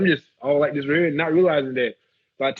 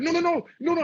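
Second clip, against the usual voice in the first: alarmed no. Retold or imitated. Guard keeps telling him to stop filming, high alarmed. Guard repeats his panicked warning.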